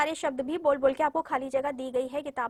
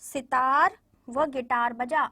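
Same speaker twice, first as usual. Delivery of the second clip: put-on very slow, each word laid out for children; slow clear reading; 5 dB louder, much slower than usual. Shown as a demonstration. Teacher reading a sentence slowly to her pupils, deliberate and clear. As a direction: read the sentence slowly so the children can follow. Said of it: "अब".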